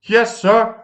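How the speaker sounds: high put-on reply; a dutiful submarine answering. A narrator's reading.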